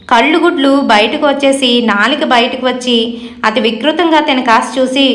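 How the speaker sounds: usual speaking voice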